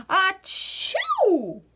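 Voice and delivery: high loud achoo; a big sneeze over dinner. A narrator's reading. Louise sneezing at dinner, loud sneeze.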